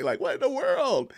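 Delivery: high incredulous question; genuinely amazed, voice leaping high. Real, not acted. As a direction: marvel at it, pitch jumping up. Amazed by worldwide viewers.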